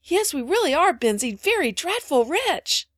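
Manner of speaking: put-on high, loud childish earnestness; insistent little girl. A narrator's reading. Phronsie convincing Ben they'll be rich, insistent. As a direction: insist on it with big, slowing emphasis.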